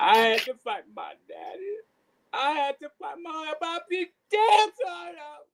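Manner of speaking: high-pitched, hammed-up wail; fake crying. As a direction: melodramatic pretend bawling. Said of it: "You gotta fake cry now".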